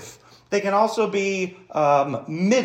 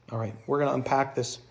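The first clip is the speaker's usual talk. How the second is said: ordinary talk, nothing marked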